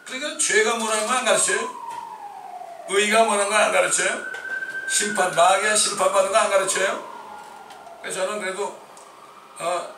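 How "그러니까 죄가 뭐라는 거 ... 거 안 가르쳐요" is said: loud, rising rhetorical challenge; fiery accusing questions; preaching shout. Real, not acted. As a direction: hammer each question at the congregation. Preacher rebuking churches loudly.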